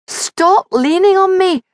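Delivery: high, loud yell to the rider behind. A narrator's reading. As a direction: shout it over the galloping horse. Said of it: "she yelled".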